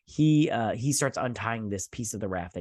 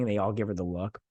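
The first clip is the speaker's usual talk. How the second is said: ordinary talk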